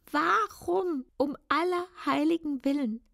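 anguished cry. Acted. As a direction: cry it out to the heavens. Verzweifelte Frage, dreimal wiederholt. (verzweifelter, lauter Aufschrei)

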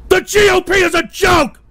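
high, loud declaration; angry high-pitched yell; furious shout. Real, not acted. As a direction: shout it out in outrage, hammering each phrase. Snaps back at a comment.

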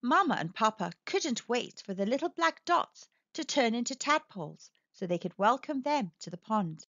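ordinary delivery

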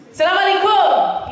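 lightly voiced taxi driver saying hello; Jimmy's greeting. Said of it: "he said".

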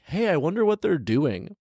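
high, dopey mock musing; mock man wondering. Vlogger mocking the man's late idea, high dopey voice. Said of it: "he's like".

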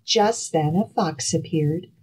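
usual speaking voice

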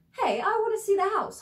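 a cheerful tenant calling the agent; caller on the phone. Retold or imitated. Renter phoning the agent to see the house, cheerful. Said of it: "you call and you say".

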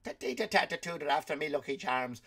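lightly put-on Irish leprechaun voice; a hint of the Irish cereal-ad leprechaun; mock Irish leprechaun, lightly done; Irish leprechaun accent. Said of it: "They did the voice".

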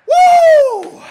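loud, very high "woo"; long, piercing celebratory holler; victory whoop. Real, not acted. Gamer celebrates winning, victory whoop.

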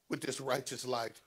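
ordinary delivery

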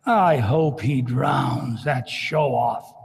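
a schemer muttering his spite; crafty Judas. Retold or imitated. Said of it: "Judas, in his crafty ways".

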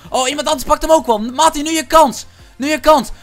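high, loud urging; urgent shouting. Real, not acted. Player spurring teammate on in a fight, urgent shout. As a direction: shout your teammate into the attack.

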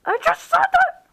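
very high, loud squealed yell. Acted. Marionette snapping back at Bonnie, high-pitched yell. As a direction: yell it out at the top of your pitch. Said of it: "Don't have to yell about it".

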